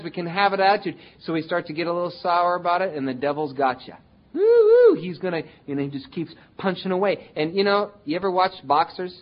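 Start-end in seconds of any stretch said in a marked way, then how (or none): 4.3-4.9 much higher, loud mock woo-hoo; gleeful whoop. Preacher voicing the devil's gleeful woo-hoo, high and loud. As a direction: whoop like the devil scoring a hit.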